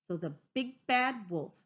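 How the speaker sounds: ordinary delivery, nothing marked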